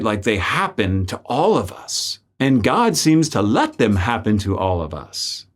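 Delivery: ordinary talk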